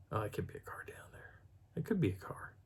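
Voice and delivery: hushed, breathy whisper of a guess; whispering to himself. Retold or imitated. Peering at lights, whispering to himself. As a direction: whisper it like you're afraid to be heard. Retold thought peering at the valley.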